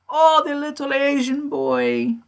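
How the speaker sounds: high, slow, drawn-out grandmother voice; old lady. Retold or imitated. Imitating a grandmother recognizing the missing person, high old-lady voice. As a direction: put on a sweet little old lady. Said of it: "She's like".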